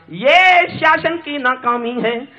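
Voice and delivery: high, slow declamation; impassioned verse. Acted. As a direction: proclaim the charge, stretching it. Climax line of stage poem.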